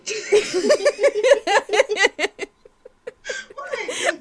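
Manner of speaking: high, loud laughing fit; helpless laughter. Real, not acted. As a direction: crack up and lose it completely. Laughing fit mid intro.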